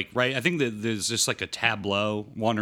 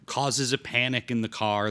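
usual speaking voice

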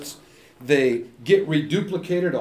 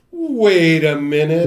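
realizing; 2 semitones higher, 8 dB louder, slower than usual. Retold or imitated. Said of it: "I'm listening later going".

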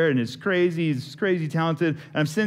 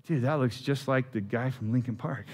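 hushed realization; 3 semitones lower, 6 dB quieter than usual. Retold or imitated. Realizing it's the famous singer.

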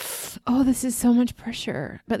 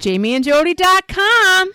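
sing-song chime in; 11 semitones higher, 9 dB louder than usual. Acted. Chiming in on the outro.

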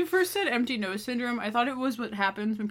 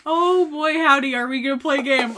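excited; 4 semitones higher, 8 dB louder than usual. Acted. Excited game opener.

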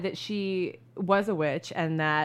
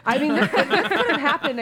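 laughing, loud and high; 7 semitones higher, 7 dB louder than usual. Real, not acted. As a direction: laugh it out in disbelief. Laughing disbelief.

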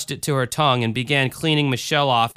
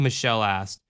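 plain reading voice

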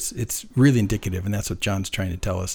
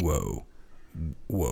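awed whoa; 8 semitones lower, 11 dB quieter than usual. Retold or imitated. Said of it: "stirred and they went".

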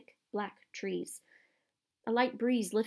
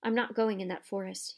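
ordinary delivery, nothing marked

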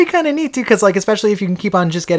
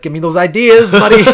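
predator ambush voice; 6 semitones higher, 8 dB louder than usual. Pretend. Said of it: "and just get you from a tree and be like".

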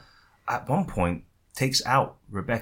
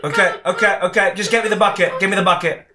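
raised, higher-pitched urgency; flustered demand; 8 semitones higher, 6 dB louder than usual. Pretend. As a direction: cut in loudly, losing patience. Flustered boss demands the bucket.

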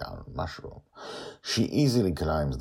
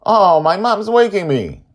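put-on high, loud child voice; he's being pulled away by his mom; boy waking up; 16 dB louder than usual. A narrator's reading. Charlie tells the crew he is waking, high child voice.

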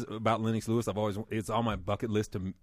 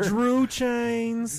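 excited joke; 13 semitones higher, 7 dB louder than usual. Real, not acted. Pun shouted in joke.

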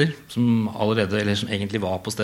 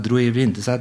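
ordinary delivery, nothing marked

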